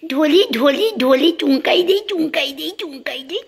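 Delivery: high sing-song coo with a laugh. Real, not acted. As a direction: call the pet's pet names sweetly. Cooing, offering kisses.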